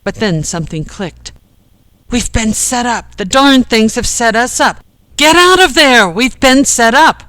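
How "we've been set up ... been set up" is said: raised voice building to an urgent high yell; loud alarmed shout. A narrator's reading. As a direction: yell a warning to get them out. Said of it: "heard Ken loud and clear".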